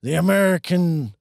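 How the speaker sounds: lightly voiced presidential speech; a hint of a rousing president; Bill Pullman impression. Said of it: "that's my Bill Pullman".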